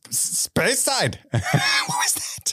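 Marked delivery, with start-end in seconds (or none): 0.1-1.2 Speyside blurted very slowly in a garbled, mock Scottish accent, much higher; botched Scottish accent. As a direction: stumble through Speyside in a failed Scottish accent. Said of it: "What did you just say?".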